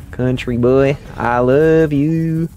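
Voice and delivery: high, slow drawn-out country croon; hammed-up country love call; country twang. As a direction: stretch it out like a lovesick cowboy. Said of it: "I know you're a country boy".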